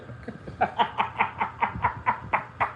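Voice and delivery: unvoiced wheezing laugh, high; real airy cackle; breathless high laugh. Real, not acted. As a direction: let a silent wheeze of a laugh go. Laughing after the rant.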